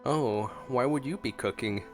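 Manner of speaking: ordinary talk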